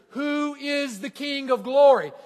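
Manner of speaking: ordinary talk, nothing marked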